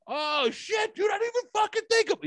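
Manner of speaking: very high, shouted oh-shit moment; sudden realization. Retold or imitated. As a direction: yelp as it suddenly clicks. Friend realizes his mistake.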